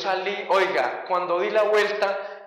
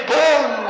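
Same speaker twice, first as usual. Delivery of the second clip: loud, high "pum" of a blow; sudden booming impact noise; imitated kick impact; 6 semitones higher, 7 dB louder than usual. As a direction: punch out the sound of a kick.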